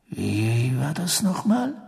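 soft, very slow question; Sirius, pondering. Acted. Sirius asks after pacing a while, pondering. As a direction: draw it out softly, thinking.

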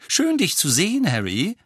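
high, loud opening with a rising name; excited greeting. A narrator's reading. Young witch greeting Harry, excited. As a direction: greet him in a high, lively voice.

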